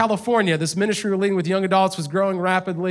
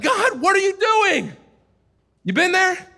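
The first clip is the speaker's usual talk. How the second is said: frustrated cry to God; 10 semitones higher than usual. Retold or imitated. Pastor retelling his frustrated cry to God, shouting high. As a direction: throw the question up at God in frustration. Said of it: "I was so frustrated".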